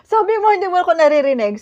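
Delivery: shouting back over a bad line, fast and laughing; half-laughing yell at the caller; retold shouting. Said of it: "So, humihiyaw ako". (pasigaw na may tawa)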